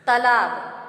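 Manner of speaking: तालाब loud, with short first vowel, "talaab"; mispronunciation. Shown as a demonstration. Teacher announcing the word, loud short-vowel mispronunciation. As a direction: call out तालाब wrongly as "tuh-laab". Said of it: "लेकिन तालाब नहीं होता है".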